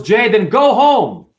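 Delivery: loud, slow, firm order; stern order. Retold or imitated. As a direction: lay down the law, slow and loud. Angry boss sending him home.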